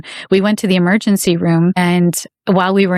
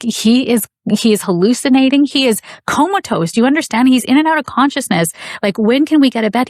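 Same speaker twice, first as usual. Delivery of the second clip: higher-pitched, indignant urgency; angry pleading; 6 semitones higher than usual. Retold or imitated. Mother to triage nurse about her sick son, angry pleading. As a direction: press the triage nurse to understand, reliving the anger. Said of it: "but I was so mad. And I said".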